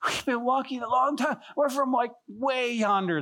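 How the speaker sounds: high, mock-weary travelers' voice; lightly hammed-up weary wanderers; weary travelers. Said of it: "and they're like".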